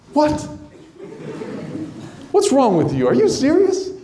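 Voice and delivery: high disbelieving what; high scoffing disbelief; incredulous. Acted. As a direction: Peter can't believe it. Peter scoffs at Andrew.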